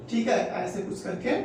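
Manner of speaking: ordinary talk, nothing marked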